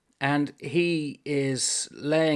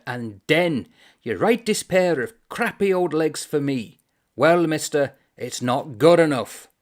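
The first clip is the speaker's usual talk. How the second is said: louder, aggrieved complaining; indignant rant; 4 semitones higher, 8 dB louder than usual. Acted. The Irishman railing at the author over his bad legs, indignant. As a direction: rail at the author about your legs.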